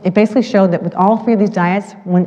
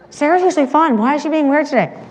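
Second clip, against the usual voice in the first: put-on higher, little kid wondering voice; kids' voices; 8 semitones higher than usual. Little kids whispering about Sarah, a higher childlike voice.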